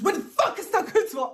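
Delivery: loud, high, barging-in shout; aggressive demand. Retold or imitated. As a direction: burst in demanding answers. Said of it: "barges the door and says".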